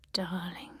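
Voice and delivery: soft whispered darling. Acted. Lee longing for Roland, whispered. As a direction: let it fade to a breath. Letter read, whispered word.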